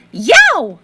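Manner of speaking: loud yelp of shock; child startled awake, loud. A narrator's reading. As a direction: jump and cry out. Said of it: "you sit up and say".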